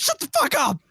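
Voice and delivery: very high, loud yell; exasperated shout. Acted. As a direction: explode in comic exasperation. Retold outburst at nagging lecturers.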